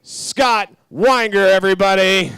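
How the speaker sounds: loud, drawn-out hype announcement; announcer intro. Acted. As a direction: build up to the guest's name. Host introduces guest.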